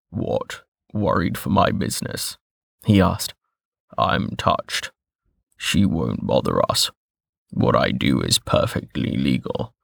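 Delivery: low, rough, dry information broker; a rough-voiced broker, amused and dismissive; gruff old broker. A narrator's reading.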